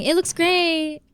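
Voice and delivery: high, slow, mock-cheery "It looks great"; bland praise. Streamer imitating viewers who only praise, mock-cheery. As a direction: imitate viewers glossing over flaws, a touch sing-song.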